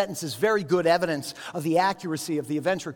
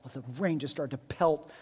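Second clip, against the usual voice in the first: ordinary delivery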